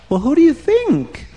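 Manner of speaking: king toying with Haman; teasing king. Acted. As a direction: tease him with the obvious.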